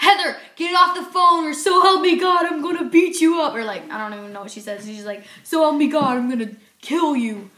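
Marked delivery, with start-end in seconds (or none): 0.1-3.6 loud, higher furious threat at a bratty sister; angry yell at sister. Retold or imitated. Sister yelling at kid sister. 5.4-7.5 mock angry threat. Retold threat to sister.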